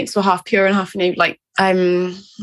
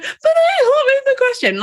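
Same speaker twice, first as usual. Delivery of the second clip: much higher, laughing mock-wail of despair; dramatic wail. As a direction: ham up the helpless pupil pleading to the teacher. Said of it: "I can be a little bit dramatic".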